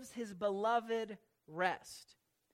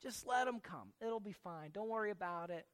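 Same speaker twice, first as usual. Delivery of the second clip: soft, easygoing mock reassurance; mild reassurance; 3 semitones lower, 5 dB quieter than usual. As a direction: let them off gently and quietly. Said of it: "Was that him just going".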